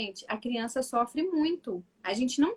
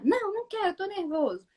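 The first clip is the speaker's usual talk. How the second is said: put-on upset child; 7 semitones higher than usual. Voicing upset son's reply.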